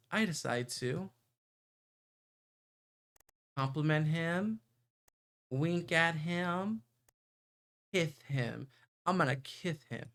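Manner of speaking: usual speaking voice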